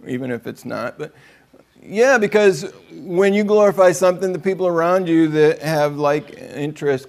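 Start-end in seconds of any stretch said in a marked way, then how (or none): none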